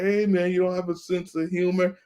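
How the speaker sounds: high, nasal-free squeaky mock voice of the boss. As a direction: pitch it way up to make the boss sound silly. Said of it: "He was like".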